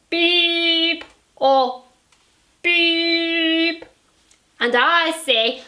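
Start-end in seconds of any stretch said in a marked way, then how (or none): 0.1-3.9 high steady beep sound; beep noise. Acted. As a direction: make a flat censor beep. Husband voicing a censor beep.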